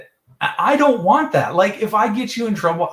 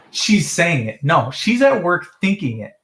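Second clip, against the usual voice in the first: ordinary delivery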